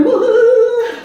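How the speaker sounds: high, loud celebratory woo-hoo; long gleeful cheer, sailing way up; excited whoop. Real, not acted. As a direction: whoop for joy. Celebrating becoming a grandmother.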